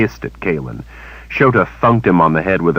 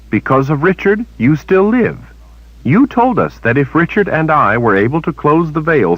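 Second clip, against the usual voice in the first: put-on higher young woman's voice, pressing her point; indignant young heroine; Kalen, indignant; 10 semitones higher, 3 dB louder than usual. A narrator's reading. Kalen confronting Shota, higher and indignant.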